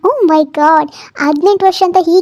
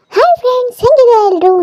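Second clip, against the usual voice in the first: bright high vlog hello, a bit louder; vlogger greeting; 8 semitones higher, 4 dB louder than usual. Acted. Wife opening her YouTube live, high vlog greeting.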